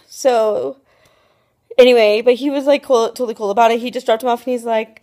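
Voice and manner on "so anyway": ordinary talk, nothing marked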